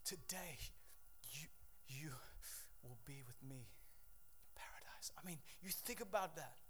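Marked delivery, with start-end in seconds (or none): 0.0-5.1 breathless whispered words of a dying man; crucified man whispering with his last breath; gasping whisper. Acted. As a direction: whisper it as if you can barely breathe. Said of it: "he can't even".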